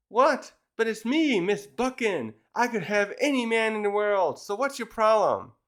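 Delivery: indignant diva. Retold or imitated. Spurned woman confronting the man who turned her down, indignant. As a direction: act out a haughty woman's disbelief.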